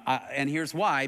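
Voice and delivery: ordinary talk, nothing marked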